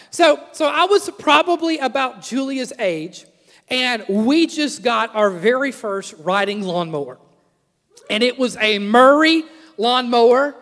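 normal talking voice

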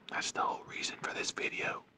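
soft whisper to the camera; whispered aside. Real, not acted. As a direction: let the viewers in on the secret, whispering. Aside to camera while teasing cat.

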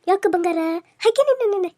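put-on high-pitched cooing to a baby; baby talk. Woman soothing a crying baby, in playful high baby talk. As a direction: coo loudly at a fussing baby.

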